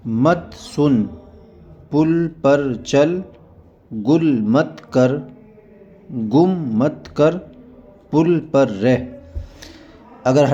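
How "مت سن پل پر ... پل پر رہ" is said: each word said on its own, with pauses; reading drill. Shown as a demonstration. Teacher reads Qaida words to students, slow drill. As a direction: read the word list slowly for learners.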